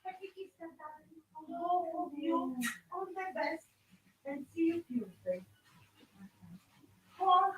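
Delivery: quiet, distant voice away from the mic; calling sweetly from the front door, off mic; far off at the door. Real, not acted. Crafter greets her visitor at the front door, heard from afar. I've got to just answer the door.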